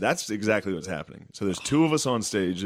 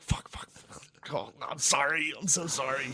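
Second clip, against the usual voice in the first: mock panicked apology; 5 semitones higher, 6 dB quieter than usual. Catching himself mid-insult, apologizing.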